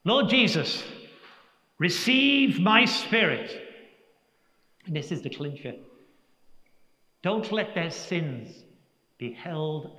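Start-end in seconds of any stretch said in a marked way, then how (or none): none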